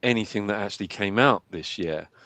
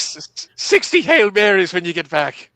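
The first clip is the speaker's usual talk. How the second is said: high, loud Irish scolding; mock Irish penance; 7 semitones higher, 4 dB louder than usual. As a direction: hand out penance in a put-on Irish accent.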